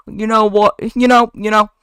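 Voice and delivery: high, loud, fed-up outburst; exasperated outburst. Acted. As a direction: snap in exasperation. Ken losing it.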